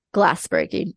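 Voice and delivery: ordinary talk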